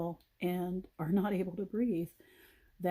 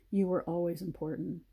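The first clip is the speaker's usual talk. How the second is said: ordinary delivery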